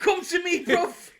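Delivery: high, loud, overdone British call; mock British summon. Riffing on the actor's summon line, hammed-up British. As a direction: bellow it like a cartoon Londoner. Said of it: "too much British".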